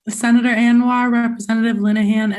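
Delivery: ordinary delivery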